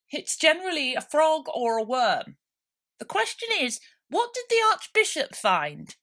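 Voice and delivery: high, loud quacky duck asking; a smug duck pressing the point. A narrator's reading.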